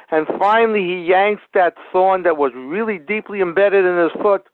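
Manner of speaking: ordinary delivery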